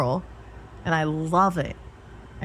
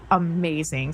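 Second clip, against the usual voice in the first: normal talking voice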